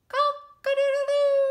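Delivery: loud, high crowing cock-a-doodle-doo; rooster crow. Acted. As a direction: crow it out like a proud rooster at dawn. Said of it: "heard a rooster crow".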